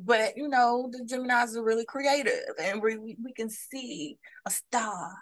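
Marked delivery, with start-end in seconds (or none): 3.2-5.1 whispered. Real, not acted. Dreamy aside about Geminis.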